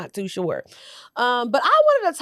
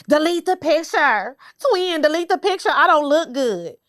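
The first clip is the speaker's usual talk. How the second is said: higher, lightly voiced upset friend; a hint of a whiny friend begging; mocking a friend; 5 semitones higher than usual. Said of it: "y'all be blocking them".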